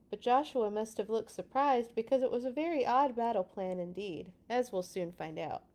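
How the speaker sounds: ordinary delivery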